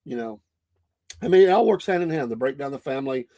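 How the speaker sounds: normal talking voice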